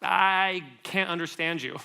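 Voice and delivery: slow, drawn-out mock bafflement; imitating a confused listener, slowly; baffled casting people. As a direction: stretch it out as if struggling to follow. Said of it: "people would be like".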